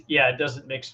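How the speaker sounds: ordinary talk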